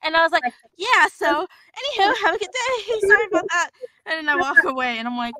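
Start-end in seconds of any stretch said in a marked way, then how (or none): 0.0-3.7 excited wrap-up. Retold or imitated. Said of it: "I caught myself".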